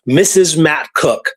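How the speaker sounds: ordinary delivery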